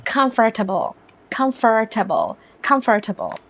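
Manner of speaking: comfortable in an American accent with a strong r, kumfer-tuh-bul. Shown as a demonstration. Teacher demonstrating American accent with forced r. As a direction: roll in the r of comfortable, American style. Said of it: "US या American accent में आर को थोड़ा ज़्यादा हम force करके कहेंगे".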